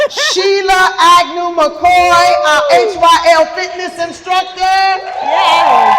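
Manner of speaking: slow, high, loud announcer call ending in a cheer; host cheering the fitness instructor's name, loud and high; hype introduction. Real, not acted. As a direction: bring her on like a star.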